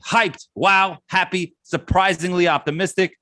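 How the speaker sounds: high, hyped rapid-fire word list; excited fan reactions read at speed. Acted. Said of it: "I'm just gonna go rapid fire and give you words".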